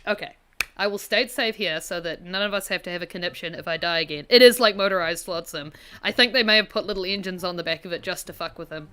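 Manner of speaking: usual speaking voice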